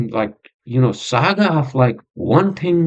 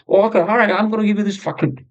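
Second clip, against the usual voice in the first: mock-agreeable, higher-pitched voice; careless doctor; 7 semitones higher, faster than usual. Podcaster mocking his doctor's quick prescribing, breezy high voice. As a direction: imitate a doctor breezily handing out pills, pitched up. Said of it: "kind of a fucking doctor I have".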